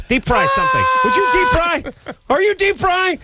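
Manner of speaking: very high, hammed-up boss yell; mock kitchen yelling. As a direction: bark orders at the cooks.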